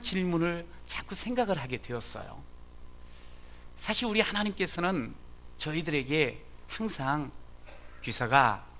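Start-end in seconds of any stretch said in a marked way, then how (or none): none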